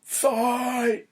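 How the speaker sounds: high, loud "fight!" call; announcer shout. Pretend.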